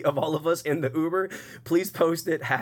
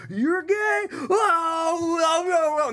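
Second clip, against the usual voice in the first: much higher, louder shocked hoots; freaking out; 4 dB louder, much slower than usual. Retold or imitated. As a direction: squeal in mock drunk surprise. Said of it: "Now the car's freaking out".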